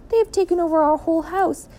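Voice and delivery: high, distressed lament; dismayed. A narrator's reading. Mother finding the house taken over, dismayed and high. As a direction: wail it in dismay.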